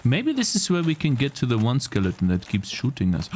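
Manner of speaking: normal talking voice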